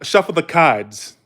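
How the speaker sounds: slow mock New England accent; dropped-R Northern accent. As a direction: imitate New England, "cards" as "cahds". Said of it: "they say cards".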